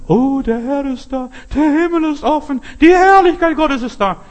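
high, loud, rising rapture that keeps climbing; mock a believer carried away with ecstasy; ecstatic outburst. Preacher parodying churchgoers flipping out, ecstatic near-shout. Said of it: "in einer Gemeinde flippen Leute manchmal aus".

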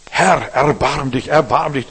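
blind man's cry. Retold or imitated. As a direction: plead out loud like the blind beggar at the roadside. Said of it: "dann schrie er".

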